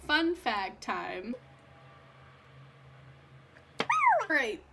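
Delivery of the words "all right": loud greeting. Real, not acted.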